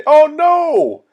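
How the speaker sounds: high, loud cry of alarm; shocked oh no. Acted. Storyteller alarmed at Moses' hand for the children, high and loud.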